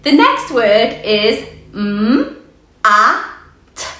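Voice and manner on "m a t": "m", "a", "t" as separate sounds; sounding out. Shown as a demonstration. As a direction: phonics sound-by-sound. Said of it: "Now let's blend it".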